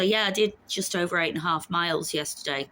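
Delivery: normal talking voice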